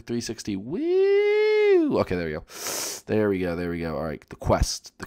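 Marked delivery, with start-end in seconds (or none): 0.5-2.0 long, high "woo"; sustained high-pitched wheee on the spin; playful whoop. Real, not acted. Spinning round, calls out.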